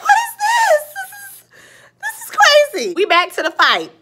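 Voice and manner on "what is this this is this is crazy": high shriek of disbelief that fades away; shocked high-pitched outcry; disbelieving exclaim. Retold or imitated. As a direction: squeal it like you can't believe your eyes. Reacting in disbelief to the scene.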